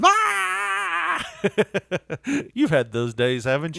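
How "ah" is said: frustrated yell. Retold or imitated. Driver driving off, mock frustrated scream. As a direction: let out a fed-up yell. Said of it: "drives off just yelling".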